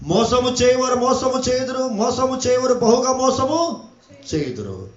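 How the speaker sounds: sustained, ringing prophetic proclamation; preaching. Acted. Preacher warning earth's inhabitants from scripture, full preaching voice. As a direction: proclaim it like a warning, stretching the endings.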